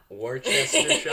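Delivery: laughing uncertain Worcestershire with rising tune; fumbling attempt. Shown as a demonstration. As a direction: try Worcestershire, unsure, laughing. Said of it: "Wor-, Wor-, God".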